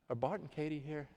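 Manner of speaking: quiet, badly faked British accent; a half-hearted British rock singer voice; terrible British accent. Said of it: "That's a terrible British accent".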